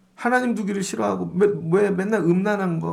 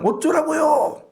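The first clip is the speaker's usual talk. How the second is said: put-on defiant kid; 9 semitones higher, slower than usual. Kid talking back to the pastor before a crowd, defiant. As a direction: throw it back like a mouthy kid. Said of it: "걔는요, 그냥".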